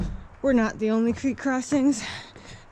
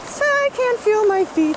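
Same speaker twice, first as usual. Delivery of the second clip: gasping from the cold; 10 semitones higher, 4 dB louder than usual. Real, not acted. Feet numb in icy water.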